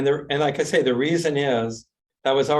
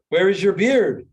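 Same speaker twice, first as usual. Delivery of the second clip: higher, louder question from a mock mother; indignant mother; 7 semitones higher, 5 dB louder than usual. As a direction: demand it as a reproachful mother.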